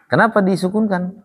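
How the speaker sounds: ordinary delivery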